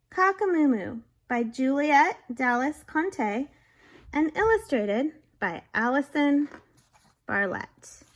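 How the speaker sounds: loud lively picture-book read; reading to children. A narrator's reading. Teacher reading a silly story aloud. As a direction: read it big for the kids.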